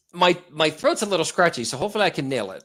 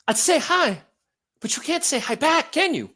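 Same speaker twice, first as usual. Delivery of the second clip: high, out-of-breath chummy greeting; breathless celebrity impression, pitched up, in short bursts; Mark Wahlberg; 8 semitones higher than usual. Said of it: "He always sounds like he's out of breath".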